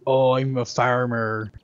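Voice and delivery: slow West Country farmer; West Country accent. Acted. Demonstrating the ooh-ah effect, slow West Country farmer accent. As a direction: draw it out in a rural West Country burr. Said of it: "this whole kind of ooh-ah kind of effect".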